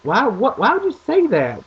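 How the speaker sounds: high, loud mock shock. Son retelling his reaction to dad, a high mock-shocked cry. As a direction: sound caught off guard. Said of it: "I was like".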